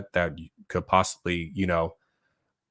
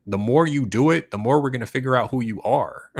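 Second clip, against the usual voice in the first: taunting challenge; 6 semitones higher, 5 dB louder than usual. Retold or imitated. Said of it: "And I'm like".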